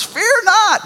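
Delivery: high, loud proclamation; a sudden angel's cry; booming angel command. Acted. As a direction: call it out like a heavenly messenger. Angel greeting quaking people.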